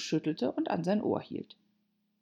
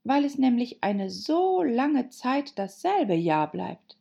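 higher, drawn-out explanation; quick-witted retort; 7 semitones higher, 4 dB louder than usual. Acted. Alice explaining years to the Hatter, high and pointed. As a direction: explain pointedly, pitched up and slow. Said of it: "versetzte Alice schlagfertig".

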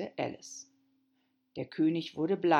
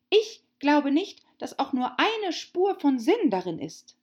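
put-on young girl voice; 7 semitones higher, 6 dB louder than usual. Alice defiant.

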